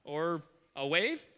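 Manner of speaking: peer and guess, low and halting; hesitant servant. Retold or imitated. Servant squinting at a far-off cloud, hesitant. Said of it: "He's like".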